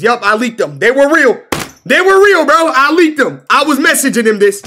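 high, loud mocking imitation of a liar; mocking Yusuf. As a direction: parrot his claim back, high and loud in ridicule.

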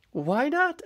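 high, curious "why not"; innocent question. Acted. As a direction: ask it simply. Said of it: "asked Wilbur".

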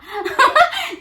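high, bright real laugh; burst of laughter. Real, not acted. Laughing at husband's retold line. (হঠাৎ জোরে হেসে ফেলা)

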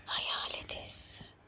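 slow eerie whisper. Real, not acted. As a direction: breathe it like a ghost. Whispered repeat. (hayalet fısıltısı)

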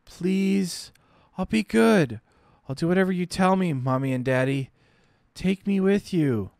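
put-on high child voice begging, a touch of crying; tearful little girl. Dying girl begging her parents, high tearful child voice. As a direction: plead like an abandoned child.

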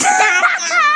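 high squealed repeat, laughing; silly squeal. Pretend. Girl repeats her silly line for friends, high laughing squeal.

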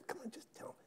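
hushed unvoiced plea; whisper. Man whispering to the narrator in a crowd. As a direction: whisper urgently in a packed crowd. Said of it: "we get into a whispering conversation".

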